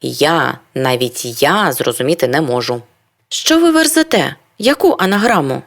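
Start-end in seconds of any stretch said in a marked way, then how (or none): 3.3-5.6 indignant surprise. Acted. Indignant reply to passenger.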